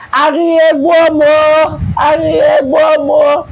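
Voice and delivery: high, drawn-out mock shout; hammed-up long calling shout; demonstrated shouting. Shown as a demonstration. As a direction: holler it out slowly, pitched high. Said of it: "Let me start shouting".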